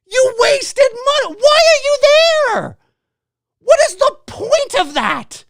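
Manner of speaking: high, loud, slow yelling; a ranter scolding moviegoers on phones, shouting; exasperated shout. Real, not acted. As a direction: yell it in total disbelief. Ranting at phone users.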